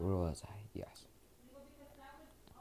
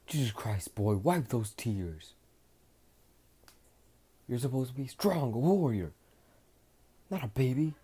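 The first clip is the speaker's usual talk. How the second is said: higher, louder tough-love scolding; 5 semitones higher, 4 dB louder than usual. Acted. Vegeta scolds the crying hero, higher and louder. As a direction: shame him into being strong.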